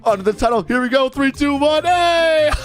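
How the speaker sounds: countdown climbing high in pitch; building excitement. Real, not acted. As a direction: count down to a daring stunt, thrilled. Stunt countdown, rising excitement.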